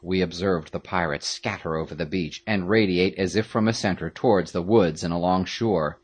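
ordinary delivery

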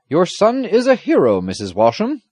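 loud, high, proud announcement; exclaiming. A narrator's reading. Squire praising the son to his mother, exclaiming. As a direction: proclaim it as you walk in. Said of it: "the squire exclaimed as they entered".

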